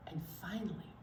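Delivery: soft hushed whisper. Real, not acted. Hushed aside to the children.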